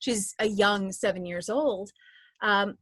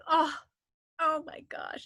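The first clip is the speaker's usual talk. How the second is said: touched; 10 semitones higher than usual. Retold or imitated. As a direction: melt at something sweet. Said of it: "I was just like".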